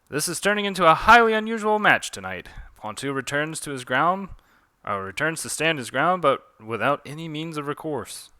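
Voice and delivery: play-by-play opening high and loud; animated sportscaster voice; match announcer. Acted. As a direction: commentate a surprising match. Calls the duel as commentator.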